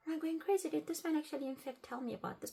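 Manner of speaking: high-pitched, bewildered questioning; retold self-doubt. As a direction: act out doubting your own memory. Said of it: "I felt like I was going crazy".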